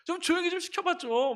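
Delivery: high, loud joking gripe; playful mock complaint. Friend jokingly complains to him, playful mock gripe. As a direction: fake the annoyance for fun. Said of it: "장난처럼".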